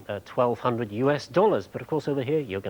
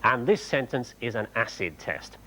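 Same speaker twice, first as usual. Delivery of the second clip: normal talking voice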